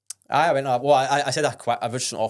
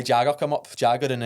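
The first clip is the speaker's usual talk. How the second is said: normal talking voice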